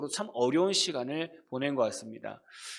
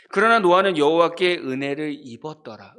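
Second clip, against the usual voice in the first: a measured, formal reading of the verse; scripture reading; 3 semitones lower, 5 dB louder than usual. Acted. Preacher reading Genesis to the congregation, formal. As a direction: read the Bible verse aloud to the congregation.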